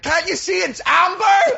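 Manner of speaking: high, slow mock yell at the driver; hammed-up road-rage shout. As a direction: yell it like an outraged motorist. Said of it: "they would have been like".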